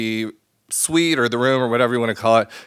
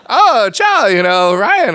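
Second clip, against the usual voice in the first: much higher, louder, startled with a laugh; surprised greeting; 6 semitones higher, 4 dB louder, slower than usual. Retold or imitated. Tour guide Marco spotting his date in the group, surprised and laughing. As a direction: caught off guard, laughing out the hello.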